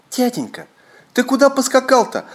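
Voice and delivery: lightly voiced boys asking; children's question. A narrator's reading. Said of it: "спрашивают".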